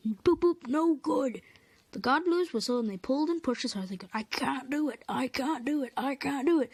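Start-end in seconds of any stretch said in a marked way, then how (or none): 0.1-1.4 higher and louder mock whistle; gloomy whistle. Gordon's gloomy whistle reply. 4.1-6.7 puffing. Acted. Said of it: "puffed Edward".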